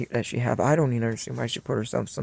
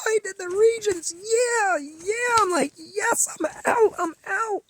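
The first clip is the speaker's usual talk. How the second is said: much higher, bubbly celebrating voice; giddy excitement; slower than usual. Retold or imitated. Telling stepdad school is over, giddy high-pitched celebration. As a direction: squeal it out like you just escaped. Retelling joy at finishing school.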